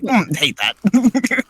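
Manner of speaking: high, slow mock disgust; disgusted face voice. Face reacting in retold session.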